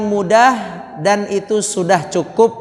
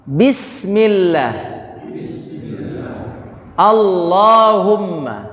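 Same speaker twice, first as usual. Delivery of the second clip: drawn-out Bismillah and Allahumma; slow repeated recitation; much slower than usual. Shown as a demonstration. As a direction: recite again slowly for the class.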